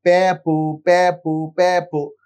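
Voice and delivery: put-on playful babbling to coax a toddler; baby babble demo. Shown as a demonstration. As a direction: model simple sounds for a baby to copy. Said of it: "sound produce করব".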